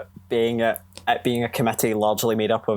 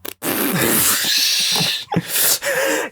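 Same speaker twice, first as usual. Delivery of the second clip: trilling high laugh in a Southern belle voice; high belle giggle; 13 semitones higher than usual. Acted. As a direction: laugh like a coquettish Southern lady, pitched way up. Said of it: "channel your inner Blanche".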